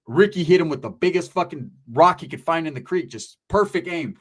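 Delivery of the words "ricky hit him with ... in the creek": normal talking voice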